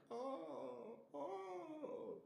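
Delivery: high, far-off overcome cries; mock sobbing. Vlogger acting the son overwhelmed, high and crying. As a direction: choke up in a hammed-up way. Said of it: "I'm like".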